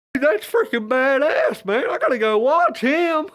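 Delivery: high, loud, slow hyped bro; hyped fan. Pretend. Acted dialogue between two dudes.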